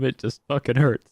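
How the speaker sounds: normal talking voice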